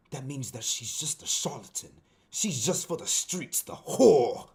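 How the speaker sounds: orate in a mock English accent, pausing often; British accent. Shown as a demonstration. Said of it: "I'm gonna read this in a British accent".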